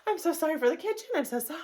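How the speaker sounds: host embarrassed by the mess, frantic high apologies; flustered apology. Retold or imitated. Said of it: "you're like".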